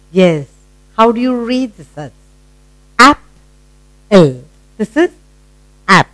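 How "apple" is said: "Apple" broken in two, long pause between; split into syllables. Shown as a demonstration. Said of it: "You have broken up".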